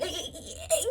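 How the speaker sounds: high, soft startled stop; quick high alarmed hey. Retold or imitated. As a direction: fend him off, startled. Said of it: "I'm like".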